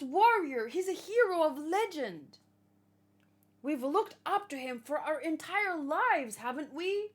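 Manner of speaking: high, loud, urgent plea to the captains; pleading young character. Acted. Young fan begs the giant captains not to attack their chief, high and pleading. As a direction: beg them to stop, voice up high.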